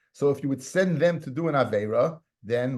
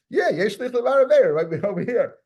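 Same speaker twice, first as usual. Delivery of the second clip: excited; 8 semitones higher, 5 dB louder than usual. Real, not acted. Student's point sparks excitement.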